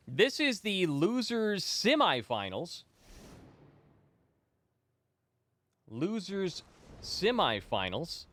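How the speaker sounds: slow, high, stretched-out ring announcer call; drawn-out announcer intro. Acted. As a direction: draw out every word to open the match.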